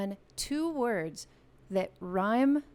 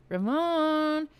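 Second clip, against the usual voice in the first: high, loud call of the name; a loud, high cheer for Ramon; 7 semitones higher, 6 dB louder than usual. Real, not acted. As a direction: shout the winner's name to celebrate. Host calls out winner.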